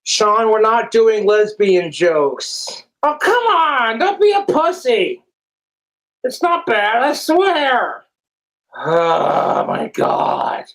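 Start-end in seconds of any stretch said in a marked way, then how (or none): 3.0-8.0 whiny pleading. Real, not acted. Pleading to tell joke.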